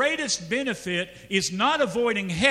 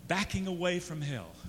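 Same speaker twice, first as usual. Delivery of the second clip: usual speaking voice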